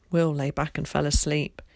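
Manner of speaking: plain reading voice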